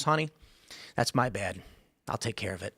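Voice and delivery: low, soft, conciliatory husband; smooth it over quietly, acting the calmed husband; gentle apology.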